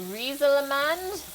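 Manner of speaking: tentative, mock French accent on Rieselemand. Streamer testing a French word on chat, mock French accent. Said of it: "I love being hilarious with accents".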